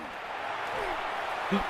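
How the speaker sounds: high, breathless unvoiced gasp of shock; sharp gasp. Real, not acted. Gamer gasps in shock at the throw. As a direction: gasp as the pass goes up.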